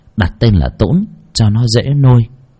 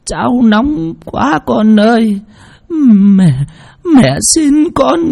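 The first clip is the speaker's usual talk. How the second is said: much higher, slow, frail imploring; old woman pleading; 12 semitones higher, slower than usual. A narrator's reading. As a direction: beg for mercy like a feeble old mother. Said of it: "luôn miệng van nài". (giọng bà cụ van nài chậm rãi)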